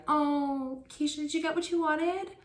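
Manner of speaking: put-on high, sing-song adult fussing over a child. Relatives fussing at little Keisha over her presents, high sing-song. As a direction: coo at her like an eager relative. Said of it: "they're asking me these weird questions".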